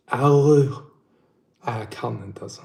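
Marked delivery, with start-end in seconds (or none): 0.1-0.7 a throaty Scanian "Arr" in a put-on voice; Skåne accent attempt. Shown as a demonstration. Chat asks him to try Skåne dialect, Scanian growl. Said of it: "Testa skånska".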